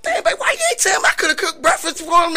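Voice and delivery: high, fast, laughing Southern granny voice; doting Southern grandma. Retold or imitated. Grandma fussing over the boys she nearly jailed, high laughing Southern granny. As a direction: play the sweet grandma offering breakfast. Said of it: "this Southern folk".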